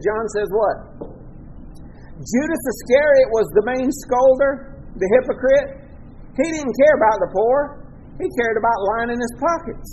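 ordinary talk, nothing marked

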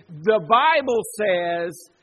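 loud, slow pulpit pronouncement; emphatic declaration. Shown as a demonstration. Preacher shows how churches now cite Scripture, declaring it loudly. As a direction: declare it with weight and volume.

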